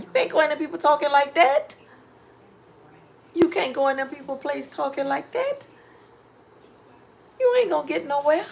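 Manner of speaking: real exasperation pushing up the voice; exasperated scolding. Real, not acted.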